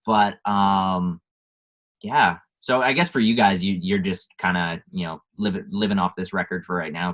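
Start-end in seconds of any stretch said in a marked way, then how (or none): none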